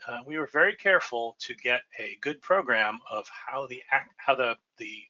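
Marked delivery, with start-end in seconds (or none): none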